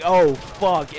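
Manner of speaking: loud, acted-out dismay; mock alarm. Dreamer learns he ate acid, loud mock alarm. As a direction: blurt it loudly as a retold reaction.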